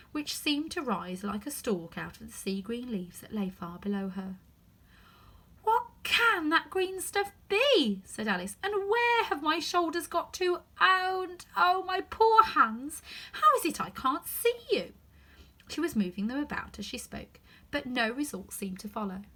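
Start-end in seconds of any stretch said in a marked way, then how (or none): 5.6-14.9 higher, louder, slow and worried; alarmed Alice. Acted. Alice looking for her hands, higher and worried. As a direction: fret over lost hands.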